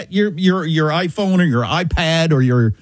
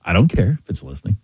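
low, offhand imitation of indifference; indifferent young people; 10 semitones lower, faster than usual. Young people answering his privacy worry, low and indifferent. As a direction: shrug it off in a lower voice. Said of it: "young people say this all the time".

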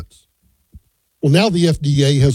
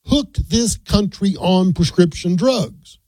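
each word spaced out, very slow; slow emphasis; 2 semitones higher, much slower than usual. Real, not acted. Radio host quoting a health secretary's charge, slow and emphatic. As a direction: land the quote word by deliberate word. Quotes a striking line, then repeats it.

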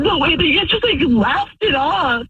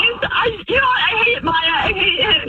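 tearful, raised yelling; crying shout; 5 semitones higher than usual. Real, not acted. Wife to cheating husband, a tearful shout. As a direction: yell it through tears.

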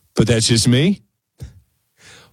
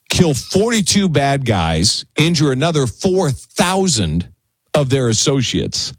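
ordinary talk, nothing marked

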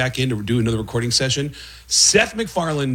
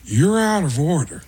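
Pacino voice, punch on out; higher Pacino take, hit out; Al Pacino impression; 5 semitones higher than usual. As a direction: Pacino courtroom bark, stress out. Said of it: "they cut to me as Al Pacino".

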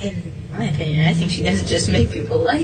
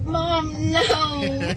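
high, whiny plea to mom; imitated mortified teen protest; embarrassed protest; 8 semitones higher than usual. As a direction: groan it high like a mortified kid.

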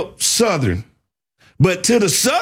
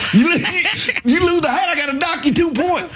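high, laughing rodeo-judge bit; laughing mock judge; 9 semitones higher than usual. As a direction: play the judge while cracking up. Mock judge scoring riders.

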